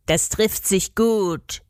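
slow, lightly voiced creature's answer; a hint of a dry goblin reply. A narrator's reading. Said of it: "entgegnete Florian". (trocken, leicht verstellte Koboldstimme)

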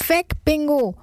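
high urgent yell at the dog; a boy yelling at his dog to move, high urgent shout; child's shout. A narrator's reading. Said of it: "schreit Nico".

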